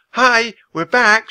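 slow, loud, high call into the room; girl greeting invisible children with a call. A narrator's reading. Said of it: "she called to the invisible children".

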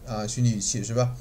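usual speaking voice

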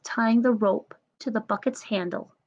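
usual speaking voice

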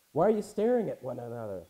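loud, snarky jab from Jacob; a father needling his idle sons, loudly. Acted. Jacob to his idle sons, snarky. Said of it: "Jacob's a little snarky sometimes".

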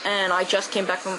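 normal talking voice

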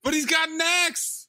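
squeaky high indignant voice; high mock protest. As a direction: pitch up, fake outrage.

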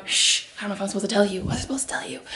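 hushed, dropping into a whisper; shush, then let it out under your breath; secretive whisper. Pretend. Letting viewers in on a secret, whispered.

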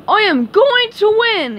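high, loud triumphant yell; Patrick yelling. A narrator's reading. Patrick runs out the door to win, yelling. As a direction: yell it like a cartoon braggart. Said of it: "he yelled".

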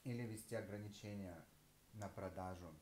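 talking while chewing; muffled by food; mouth full. Real, not acted. Seen 2: Bites food at -1, jaw still working while speaking early on.

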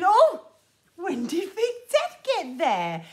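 sudden loud, high gasp of surprise; mock surprise. Leader spotting Big Ted, pretend surprise. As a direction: burst out as if startled.

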